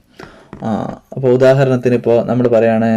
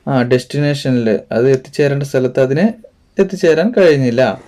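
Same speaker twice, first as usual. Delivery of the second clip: normal talking voice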